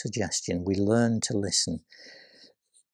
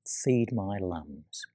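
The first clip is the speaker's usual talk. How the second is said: slow, soft and low command; Jesus quietly commissioning Peter, slow and soft; 4 semitones lower, 6 dB quieter, much slower than usual. Acted. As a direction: say it slowly and gently, restoring him. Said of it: "the quiet voice of Jesus".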